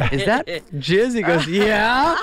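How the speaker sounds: rising shocked question, high and slow; high disgusted disbelief. Retold or imitated. David discovering the magazine, high shocked voice. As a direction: squeal it out in disbelief. Retold gross-out exchange.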